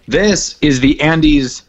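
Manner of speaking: ordinary talk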